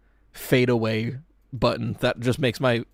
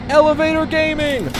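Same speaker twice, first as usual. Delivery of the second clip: high, loud gleeful shout; excited cheer; 5 dB louder than usual. Real, not acted. Cheering at the gameplay.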